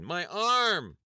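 wife shrieking as her arm is twisted; shriek of pain. A narrator's reading. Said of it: "She shrieked in excruciating pain".

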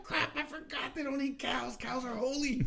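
high, slow comic dismay; hammed-up high-voiced panic; mock panic. Retold panic after cultural blunder.

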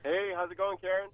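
distant, faint voice off the mic; speaking from across the room; far from mic. Real, not acted. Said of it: "It sounds like you're really far away".

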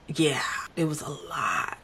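hushed aside; whisper. Real, not acted. Vlogger whispering to viewers about the meltdown, hushed. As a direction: drop to a whisper, just between us.

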